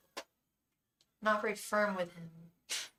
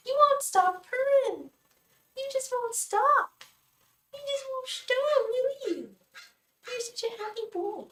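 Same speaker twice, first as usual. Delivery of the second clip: gushing pet talk; 3 dB louder than usual. Real, not acted. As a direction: squeal with delight at a purring cat. Gushing at the purring cat.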